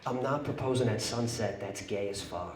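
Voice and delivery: very soft, low aside; hushed, low deadpan confidence; retold whisper. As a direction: murmur it secretly into someone's ear. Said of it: "I whisper in her ear".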